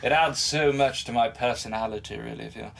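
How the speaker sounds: mock British gentleman's accent; hammed-up proper British voice. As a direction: put on a genteel English accent, unhurried. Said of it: "talk like people from U K".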